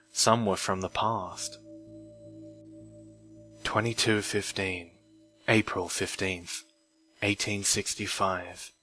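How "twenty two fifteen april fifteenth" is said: plain reading voice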